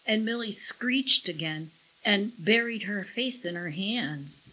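usual speaking voice

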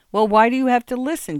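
husband pressing his weeping wife; exasperated. A narrator's reading. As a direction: demand an answer, fed up. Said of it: "Jim asked again".

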